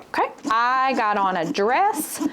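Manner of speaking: normal talking voice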